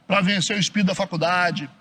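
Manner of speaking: normal talking voice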